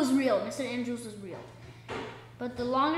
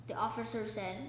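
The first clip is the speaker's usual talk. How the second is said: plain reading voice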